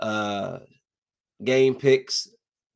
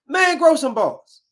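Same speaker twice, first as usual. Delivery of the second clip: much higher, louder and slower, "grow" punched; exasperated outburst; 7 dB louder, slower than usual. Real, not acted. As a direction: scold the wimp on screen. Ranting at TV characters.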